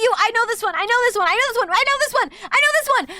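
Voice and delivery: high, loud, rapid repetition; excited outburst. Real, not acted. Listener who knows the joke, loud excited interruption.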